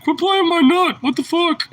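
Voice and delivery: high, loud squeaky dog voice; voicing the dog. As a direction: speak for the annoyed dog. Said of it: "It's like".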